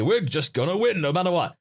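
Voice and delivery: hammed-up stubborn fighter voice; mock Saiyan boasting. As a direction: parody a proud warrior's vow. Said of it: "as they've said quite a lot of times".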